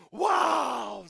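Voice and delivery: a loud, astonished wow; amazed wow. Retold or imitated. The man hears the speaker has converted, loud amazed wow. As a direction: blurt it out in loud surprise.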